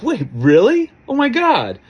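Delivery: high, imitating giddy amazement; stunned disbelief. Cyclists mimicked gushing over the found AirPod.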